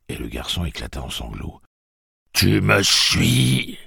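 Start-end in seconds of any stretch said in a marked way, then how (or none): 2.3-3.8 slow, loud, higher demand; slow shouted demand. A narrator's reading. Tic-Tac shaking Jake, slow loud demand. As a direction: bark it slowly. Shakes the boy.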